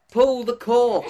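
high, loud yell through laughter; yelled command. Acted. Telling a joke, the Aussie yells to the monkey. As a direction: shout it across the field. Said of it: "the Aussie yells".

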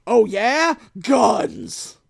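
loud, gleeful reveal; spring the trap with a shout; loud showy mock threat; mock triumphant shout. Gang pulls guns, acted.

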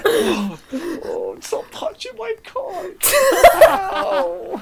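put-on squeaky wailing kid, slow; high crying child. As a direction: wail like a hurt little boy.

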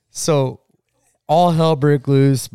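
usual speaking voice

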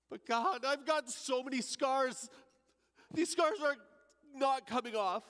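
high, urgent protest of guilt; pleading. Acted. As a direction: plead with rising desperation. Thief pleading with God.

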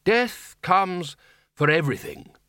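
slow, weighty pronouncement; firm. A narrator's reading. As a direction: declare it with finality, slowly. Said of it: "said the Arch-Chancellor firmly".

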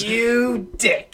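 high-pitched huffy insult; mock offended. Drunk buddy snubbed at bedtime, mock-offended insult. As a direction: imitate a sulky drunk's parting jab. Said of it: "the last thing you say to me was".

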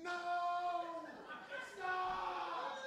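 reliving yelling at the dog to stop; retold screaming. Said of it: "I'm screaming in the top of my lungs".

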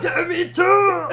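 high, loud and very slow; drawn-out shout. Retold or imitated. Host answering his cohost's quote with a drawn-out shout. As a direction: stretch the film cry out long.

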